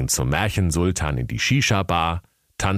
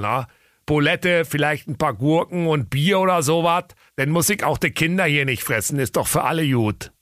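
put-on Berlin accent; 8 semitones higher than usual. A narrator's reading. Bear character voice.

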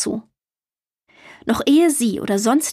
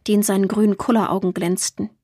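ordinary delivery, nothing marked

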